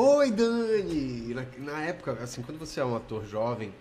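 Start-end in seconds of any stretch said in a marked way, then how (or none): 0.0-1.3 drawn-out, high, sweet "Oi, Dani"; eager phone greeting. Retold or imitated. Young actor answering the TV network on the phone, eager and slow. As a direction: stretch it slowly like a star-struck young actor. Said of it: "você já fala meio diferente".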